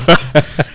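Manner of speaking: laugh. Real, not acted. Laughs at hamster joke.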